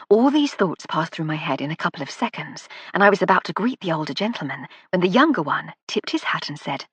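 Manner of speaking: plain reading voice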